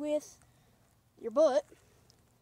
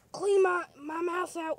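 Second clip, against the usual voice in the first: yelling; 3 semitones higher, 9 dB louder than usual. Acted. Sick toy yelling.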